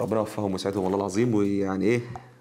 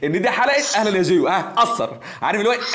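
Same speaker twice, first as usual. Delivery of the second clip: loud, higher, laughing bursts through the words; 8 semitones higher, 5 dB louder than usual. Real, not acted. Teacher laughing loudly when a student recognizes his voice. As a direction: crack up at being caught. Caller recognized him instantly.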